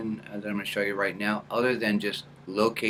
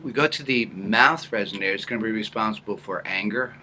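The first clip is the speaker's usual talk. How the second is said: ordinary talk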